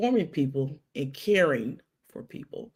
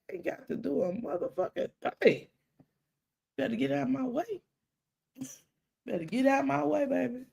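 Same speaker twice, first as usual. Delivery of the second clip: sassy warning, pitched a bit higher; defiant retort; 7 semitones higher than usual. Retold or imitated. To the cousin at her mama's funeral, defiant sass. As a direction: put her firmly in her place.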